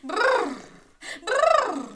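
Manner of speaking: high, loud teeth-chattering brrr; shivering brrr. Shown as a demonstration. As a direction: shiver out loud like you're freezing. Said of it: "pretend to be cold and shiver".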